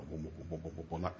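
soft, low repeated booms; hushed, low rumbling booms; echoing footsteps imitation. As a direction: mimic thuds echoing up a stairwell. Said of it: "it was like it was an echo".

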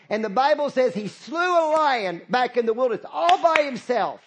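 loud, high preaching at full force; rousing pulpit preaching. Acted. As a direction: preach it big to the congregation.

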